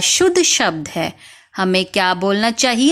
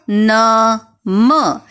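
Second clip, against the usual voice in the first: ordinary talk, nothing marked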